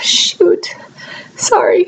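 whispered through tears; tearful whisper. Real, not acted. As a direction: hush it while trying to stop crying. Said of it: "I just had a little crying spell".

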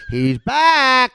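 sudden loud yell. Retold or imitated. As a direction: shout it out suddenly. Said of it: "I yelled".

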